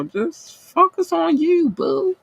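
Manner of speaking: zing het hoog en lief, traag uitgerekt; high cooing voice. Pretend. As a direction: coo it sweetly like a pet name, slowly. Sweet high sign-off to viewer.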